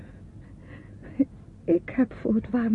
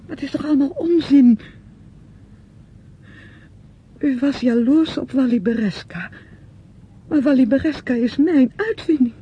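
louder, higher, accusing; angry outburst; 5 semitones higher, 7 dB louder than usual. Acted. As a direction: let it burst out in anger. Uitbarsting in ruzie. (boze uitbarsting tegen de ander)

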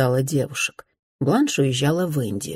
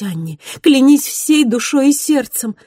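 passionate vow; 9 semitones higher, 6 dB louder than usual. Acted. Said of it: "проливая слёзы".

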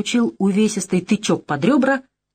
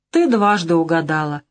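sighing. Acted. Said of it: "вздохнул он".

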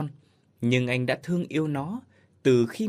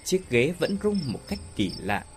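ordinary delivery, nothing marked

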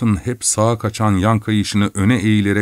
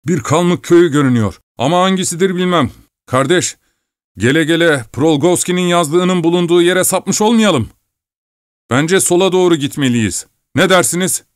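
out of breath; 8 semitones higher than usual. Acted. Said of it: "Ignat soluk soluğaydı".

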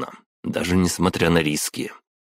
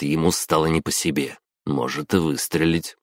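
plain reading voice

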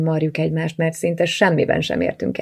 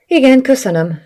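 high, loud, overdone politeness; fibbing; 8 semitones higher, 7 dB louder than usual. A narrator's reading. Sister fibs to brother about her night, fibbing politely. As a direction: cover the truth with a raised, too-ready reply. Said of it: "füllentettem".

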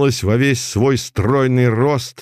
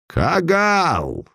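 much higher, ringing shout; funereal shout; 9 semitones higher than usual. A narrator's reading. The tribunal answers the girl with a shout. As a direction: boom the tribunal's name. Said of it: "Погребальным звуком раздался".

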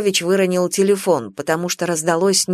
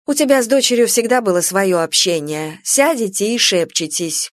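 plain reading voice

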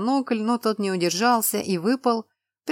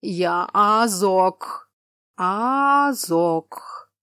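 very slow, louder, stretched words; slow drawling giant; 5 dB louder, much slower than usual. Acted. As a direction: drag each word like a slow giant. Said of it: "Говорил он медленно, растягивал слова".

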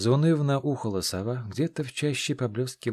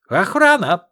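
much higher, much louder cry for the guards; panicked yell; 11 semitones higher, 13 dB louder, slower than usual. Acted. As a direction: scream for help, voice cracking. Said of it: "заорал он сорвавшимся голосом".

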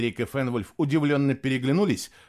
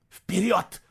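high, urgent, panicky hiss; panicked whisper; 7 semitones higher than usual. A narrator's reading. Boy hears searchers upstairs, panicked urging. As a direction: urge them on in a panic. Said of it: "в панике прошептал Фло".

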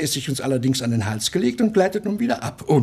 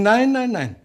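firm refusal; 9 semitones higher, slower than usual. Acted. Husband flatly refuses.

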